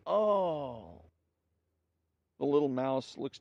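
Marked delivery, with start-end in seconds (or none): 0.1-1.0 a sharp, high pained "Oh!" with the head thrown back; cry of pain. A narrator's reading. Elephant hit on the head by a coconut, hammed-up pained cry. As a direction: yelp as if struck, hammed-up but light. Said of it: "cries in pain".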